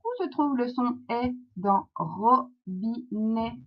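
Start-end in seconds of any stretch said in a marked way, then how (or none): 2.0-3.6 "robinet" in three beats, ro... bi... net; split into syllables. Shown as a demonstration. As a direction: pause between each syllable of "robinet". Teacher splits word into syllables.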